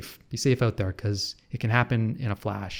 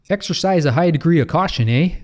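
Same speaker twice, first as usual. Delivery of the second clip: hammed-up Canadian accent, higher and louder; 6 semitones higher, 6 dB louder than usual. Canadian vlogger mocks the travel warning in a hammed-up Canadian accent. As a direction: play up the Canadian accent with a big eh. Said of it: "I don't need A I to do the accent".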